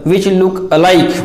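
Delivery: usual speaking voice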